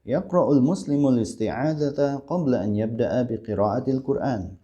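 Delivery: connected, flowing Arabic model reading; Arabic accent with long vowels, words joined; proper Arabic accent. Shown as a demonstration. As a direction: read it as Arabs speak, linked in one run. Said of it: "Begitu ya bacanya".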